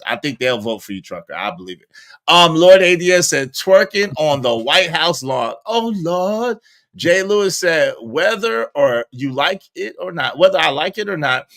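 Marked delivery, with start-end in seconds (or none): none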